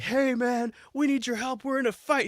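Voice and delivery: pleading allies. Retold or imitated. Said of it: "they're like".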